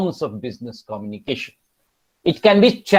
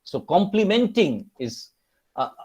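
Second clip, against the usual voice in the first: ordinary talk, nothing marked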